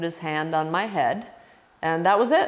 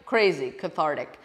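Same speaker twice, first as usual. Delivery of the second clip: normal talking voice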